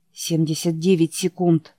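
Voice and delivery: ordinary delivery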